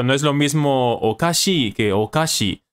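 slow, contrast the two okashi pitch patterns; Japanese pitch accent demo. Shown as a demonstration. Teacher showing Japanese pitch accent to viewers, slow contrast. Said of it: "esta cosa que tiene el japonés".

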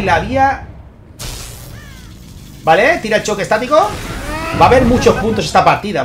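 lively esports caster calling the action; energetic game commentary; live play-by-play. Acted. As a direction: call the match as it unfolds for the viewers. Live casting of tournament match.

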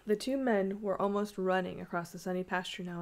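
usual speaking voice

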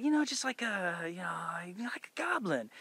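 imitating the director, high and hushed, fading to a whisper; a secretive, breathy tip trailing off into a whisper; whispered hint.

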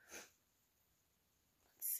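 soft whispered aside; hushed little aside. Real, not acted. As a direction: whisper it as you turn the page. Turning page to reveal.

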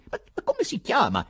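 ordinary delivery

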